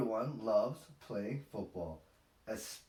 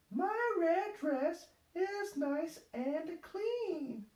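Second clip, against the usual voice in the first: high, slow little-pig voice; proud, slow, high as Peppa; slower than usual. A narrator's reading. Peppa voice held.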